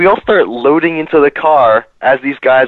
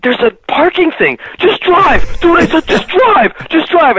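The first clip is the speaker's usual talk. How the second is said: high, urgent insistence, pitched way up; frantic urging. Retold or imitated. Friend in the back seat begging Fluffy to drive. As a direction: push him hard to just drive. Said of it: "Todd is like".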